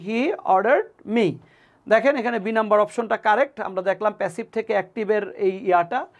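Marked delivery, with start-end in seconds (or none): 0.1-1.3 He ordered me spelled out word by word; teacher reading the answer slowly; slow dictation. Shown as a demonstration. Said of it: "the correct answer should be".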